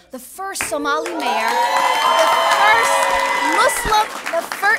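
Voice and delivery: triumphant rising shout; rousing shout. Real, not acted. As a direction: call it out to the cheering room. Rousing crowd with her firsts.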